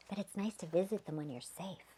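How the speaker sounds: usual speaking voice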